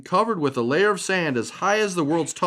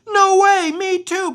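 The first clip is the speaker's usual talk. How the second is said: delighted surprise; 7 dB louder, slower than usual. Acted. Pebbles surprised meeting butterfly.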